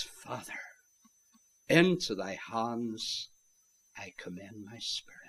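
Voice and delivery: hushed committal, fading almost to nothing; calm, majestic. Retold or imitated. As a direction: breathe it out with stately calm. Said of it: "in these calm, majestic words".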